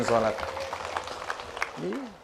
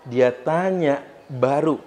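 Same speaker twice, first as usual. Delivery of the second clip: normal talking voice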